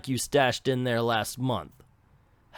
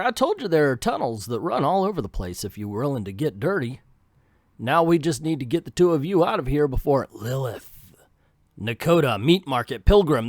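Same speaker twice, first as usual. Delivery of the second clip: louder southern caretaker voice; southern accent; 2 semitones higher, 7 dB louder than usual. Acted. Caretaker showing the way out, in a southern accent. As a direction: boast about the tunnels in a southern twang. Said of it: "I changed the southern accent".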